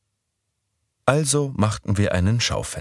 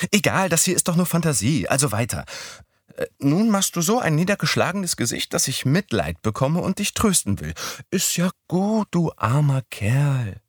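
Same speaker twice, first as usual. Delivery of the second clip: put-on higher young woman's voice; Midori's voice; 9 semitones higher than usual. A narrator's reading. Narrator voicing Midori proposing Sunday, higher voice. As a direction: pitch up for the girl.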